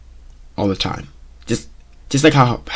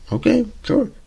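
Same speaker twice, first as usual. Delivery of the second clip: sarcastic okay sure; 13 semitones higher, 4 dB quieter than usual. Retold or imitated. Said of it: "wait and see what happens".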